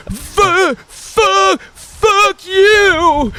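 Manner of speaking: very high, strained tearful mock outburst; crying kid. Imitating the stuttering kid as he breaks down, a high tearful squeal. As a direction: squeal it like a kid about to cry. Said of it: "see the tears streaming down his face".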